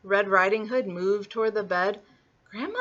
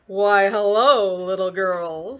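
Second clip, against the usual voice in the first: slow, lightly voiced sly wolf; charming wolf; 6 dB louder, slower than usual. Wolf greeting Little Red in the forest, slow charm. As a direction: draw out a smooth greeting.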